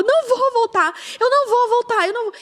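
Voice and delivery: high, loud refusal; defiant shouting. Retold or imitated. Wife shouting her refusal at her husband, defiant. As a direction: refuse him at full voice, out of control. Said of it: "eu tava fora de mim".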